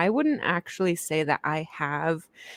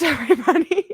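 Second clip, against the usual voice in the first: higher, louder laughing welcome; 10 semitones higher, 7 dB louder, faster than usual. Real, not acted. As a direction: laugh through the greeting.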